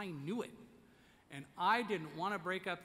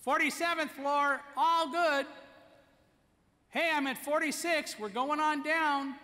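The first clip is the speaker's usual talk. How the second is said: loud, high call down the stairwell; calling out floors; 10 semitones higher, 10 dB louder than usual. Retold or imitated. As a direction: sing out the floor number for everyone to hear.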